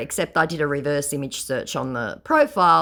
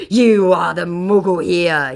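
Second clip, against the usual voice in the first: slow, louder snarling put-on voice; mock snarl; 4 semitones higher, 8 dB louder, slower than usual. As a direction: snarl it back like a cornered scammer. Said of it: "he snarls".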